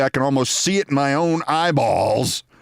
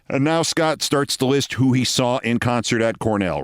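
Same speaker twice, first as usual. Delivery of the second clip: normal talking voice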